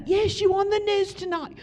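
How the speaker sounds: high, lively British pastor on the phone. Retold or imitated. English pastor phoning the preacher, high and excited. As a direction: play the excited English pastor calling her. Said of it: "He's like".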